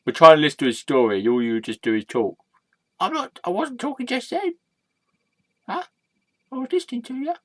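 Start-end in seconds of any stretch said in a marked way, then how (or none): 3.0-7.3 high, soft, wounded little voice; meek squeaky alter ego. Acted. Scolded sidekick insists on being good, small and hurt. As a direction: protest innocence in a tiny high voice.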